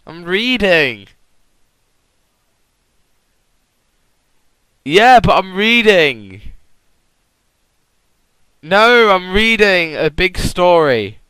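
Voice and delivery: loud, slow exasperated yelling; shouting. Real, not acted. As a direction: insist over them, loudly. Arguing while reading story.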